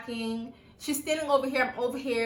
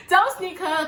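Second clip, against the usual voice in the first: higher, louder, laughing through it; laughing repeat; 7 semitones higher, 6 dB louder than usual. Retold or imitated. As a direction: laugh the words out. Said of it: "But I'm like".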